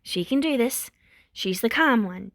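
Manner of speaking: usual speaking voice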